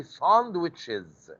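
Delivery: "sandwiches" said slowly, ending on a clear IZ. Shown as a demonstration. Teacher modelling a plural slowly for the class, "sandwiches" with IZ. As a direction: draw out "sandwiches" so the IZ ending is heard. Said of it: "if you want to make it plural".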